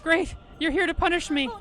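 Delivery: high, loud, desperate pleading; frantic begging character. Acted. Guilty character begging Yi to punish her, frantic loud pleading. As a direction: throw yourself at their feet, begging.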